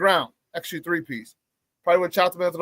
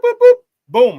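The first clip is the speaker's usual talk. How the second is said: high, loud "boop boop boom" punch noises; punch sound effects; 14 semitones higher, 10 dB louder than usual. Retold or imitated. Imitates a flurry of punches.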